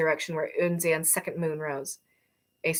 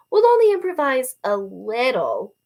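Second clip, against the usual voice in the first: slow, loud, playful; smirking tease; 7 semitones higher, 8 dB louder than usual. Acted. Arrow teasing Badge about improvising, smirking. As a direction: tease her with a grin. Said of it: "Arrow smirked".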